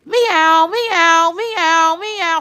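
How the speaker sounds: high-pitched, repeated meows; imitated cat meowing. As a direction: mimic an insistent cat, high and drawn. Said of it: "the cat sounds like it's inside my room".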